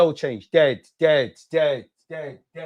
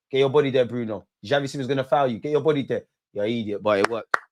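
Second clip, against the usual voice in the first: normal talking voice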